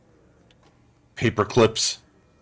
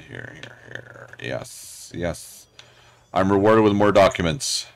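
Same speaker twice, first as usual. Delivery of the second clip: normal talking voice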